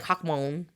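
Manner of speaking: Hackman said as "hock-mone"; mispronounced surname. Shown as a demonstration. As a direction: round HACKMAN into "hock-mohn". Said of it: "that's not how you say it".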